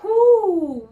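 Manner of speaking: high, loud cheering "whoo"; excited whoop. Real, not acted. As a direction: let out a big drawn-out whoo. Teacher cheers before the next word.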